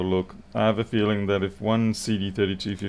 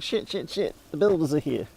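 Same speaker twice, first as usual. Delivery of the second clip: panicked cat; 7 semitones higher than usual. Pretend. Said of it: "and you'll be like".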